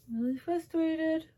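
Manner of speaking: "frustrated" pitched very high, drawn out slowly; very high, slow comic whine. Real, not acted.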